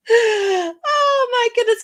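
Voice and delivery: high, slow exclamation through laughter; laughing delight. Real, not acted. Reacting to the accordion message.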